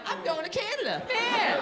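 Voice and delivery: high, rising whiny reply; whiny retort. Retold or imitated. Comic answering the bully, high whiny retort. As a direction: whine it like a scared kid. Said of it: "And I'm like".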